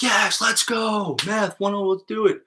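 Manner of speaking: high-pitched mock cheer; excited student. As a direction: imitate an eager student cheering, without real volume. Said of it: "Catherine's probably like".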